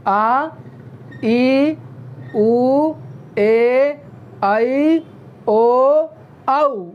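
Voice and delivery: आ, ई, ऊ, ए, ऐ, ओ, औ each held long; long vowel demo. Shown as a demonstration. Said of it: "तो". (दीर्घ स्वर, खींचकर बोलें)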